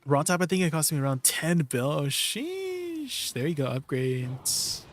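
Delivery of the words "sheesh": high, stretched-out oh sheesh in disbelief; amazed sheesh. Real, not acted. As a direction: gasp it out, impressed. Another big upgrade reaction.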